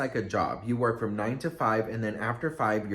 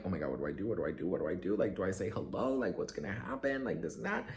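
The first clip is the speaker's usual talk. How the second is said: softer, lower, rushed inner panic; 7 semitones lower, 10 dB quieter, faster than usual. Retold or imitated. Panicking to himself beside the star, a soft lower murmur. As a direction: murmur it like frantic thoughts in your head. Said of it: "in my head".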